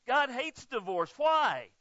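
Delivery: loud, high, preaching force; declaring it to the congregation, rising loud; preaching emphasis. Acted. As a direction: punch it out from the pulpit. Preacher's punch line.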